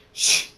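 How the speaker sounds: sudden sharp hissed whoosh; short explosive hiss; loud shh noise. A narrator's reading. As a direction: cut in with a loud "shh" effect. Sound effect cuts off speech.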